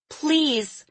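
put-on desperate girl's plea; pleading cry. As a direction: beg him not to go. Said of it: "Lily cried".

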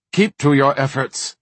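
firm, clipped command; sharp snap. Acted. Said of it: "Varden snapped".

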